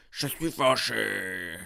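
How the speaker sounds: deep, slow, deadpan mock anger; fake angry. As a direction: put on a flat, exaggerated angry face voice. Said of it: "Je vais prendre un air fâché, je vais dire".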